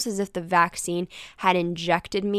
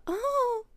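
nervous oh. Shown as a demonstration. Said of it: "Not nervous in, like, a".

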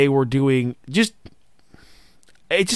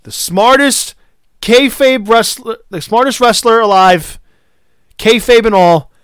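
higher and louder, excited shouting; excited fan shout; retold scream; 9 semitones higher, 7 dB louder than usual. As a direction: proclaim it like you yelled at the TV. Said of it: "I screamed this when I was watching it".

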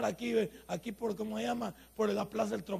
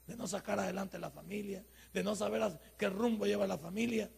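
usual speaking voice

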